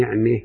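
نعمة with the fatha leaning toward kasra, "ne'me"; نعمة with small imala; imala on ni'ma. Shown as a demonstration. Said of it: "أهو ده اللي يقصده".

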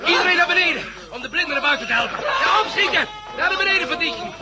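urgent shouting. Acted. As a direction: rush everyone down the stairs. Panicked evacuation shouts. (gehaast en luid roepend)

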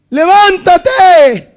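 Jesus raising his voice on levántate. Shown as a demonstration. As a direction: call out levántate with lifted force.

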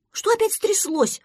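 high-pitched startled question; surprised. A narrator's reading. Duckling responds to friend's cry, surprised. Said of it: "удивился утёнок".

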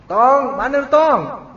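put-on loud, high call to a kid across the street; Betawi calling out. Betawi elder calling the boy, loud high call.

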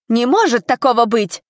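narrated outburst of disbelief; disbelieving shout. A narrator's reading. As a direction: call it out from the crowd. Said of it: "закричал кто-то в толпе".

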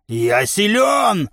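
giant's drawn-out bellow; roaring boast. A narrator's reading. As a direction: roar out your strength. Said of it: "взревел гигант".

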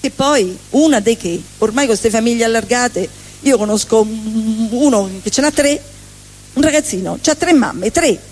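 normal talking voice

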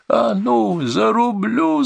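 very high, drawn-out wail; mother howls as she charges; howling threat. A narrator's reading. Mother with axe charges the hostage-taker, howling. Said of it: "завыла та".

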